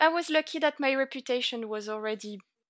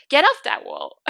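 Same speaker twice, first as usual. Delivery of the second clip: Irish saying exclaimed; 4 semitones higher, 9 dB louder than usual. Retold or imitated. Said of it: "in Ireland, it's like".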